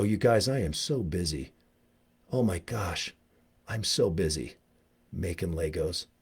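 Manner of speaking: soft, low mock-overwhelmed voice; pretend busy. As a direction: feign being swamped, softly and low. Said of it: "I pretend I'm busy all the time".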